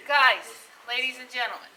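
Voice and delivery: loud call for attention. Real, not acted. Calls class to attention.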